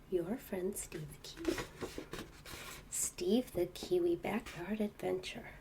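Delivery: soft quiet storybook reading; bedtime reading. Acted. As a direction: read softly to a child in bed. Reading book to child.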